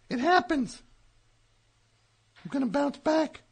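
ordinary delivery

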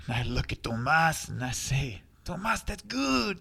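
lightly put-on Spanish accent, joke telling; a hint of a Spanish accent telling a party joke. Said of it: "always used an accent, so that's the only way I know how to tell it".

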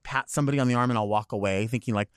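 ordinary talk

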